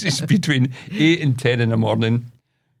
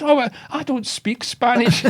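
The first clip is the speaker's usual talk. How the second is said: very high old-lady impression; mock old lady, high protest; wee old woman; 14 semitones higher than usual. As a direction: imitate a baffled old woman, pitched right up. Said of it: "this wee old woman".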